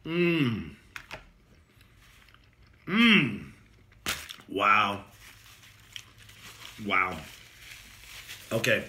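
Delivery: chewing while humming; talking between bites; mouth full. Real, not acted. Seen 3: Bit sandwich at -3; jaw still working, mouth closed during hums.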